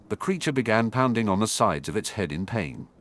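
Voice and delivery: ordinary delivery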